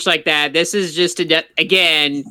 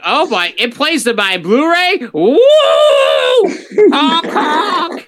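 silly clown; 8 semitones higher, 3 dB louder, slower than usual. Pretend. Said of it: "it's like".

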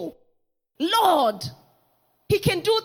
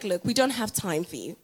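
low, quiet dismissal; curt brush-off; 11 semitones lower, 5 dB quieter than usual. Retold or imitated. Disciples turning the mother away, low and curt. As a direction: shut her down, low and soft. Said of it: "The disciples were like".

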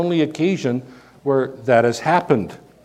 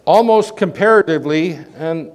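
ordinary talk

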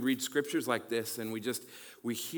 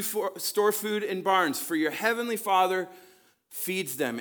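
higher and louder, a bold reading; preaching scripture; 5 semitones higher, 6 dB louder than usual. Acted. Pastor reads Jesus's words to students, preaching. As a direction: lift the words up to the listeners.

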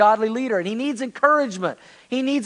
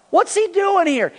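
usual speaking voice

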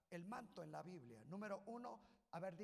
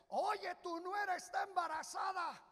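shouted news; 9 semitones higher, 11 dB louder than usual. Acted. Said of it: "ya le dicen".

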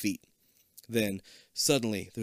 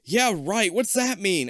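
scoffing, louder, higher; huffy; 9 semitones higher, 6 dB louder, slower than usual. Acted. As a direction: huff it out dismissively. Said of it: "Abby huffs".

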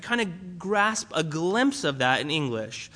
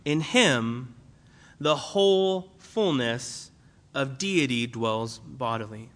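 slow emphasis; 6 semitones lower, slower than usual. Acted. Repeats verse slowly for weight.

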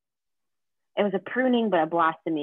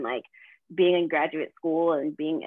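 ordinary delivery

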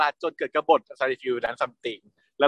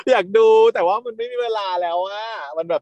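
playful whining; 6 semitones higher than usual. Pretend. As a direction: whine your excuse as if about to cry. Playfully complaining of no time to watch.

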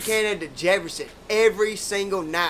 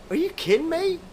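quoting her, pitched much higher in disbelief; mock shocked date; 10 semitones higher than usual. The Hooters girl hearing he quit rodeo, mock shocked. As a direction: play her jaw-drop, high and amazed.

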